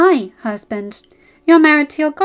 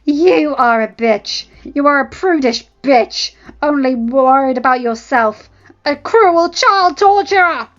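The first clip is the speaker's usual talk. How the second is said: loud, slow, raised yell; yelled insult; 5 semitones higher, 11 dB louder, slower than usual. Acted. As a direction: yell it back at her. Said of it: "Ella yelled in return".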